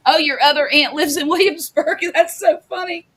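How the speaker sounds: high, laughing through words; giggling as she talks. Real, not acted. Laughing, mimicking aunt remark.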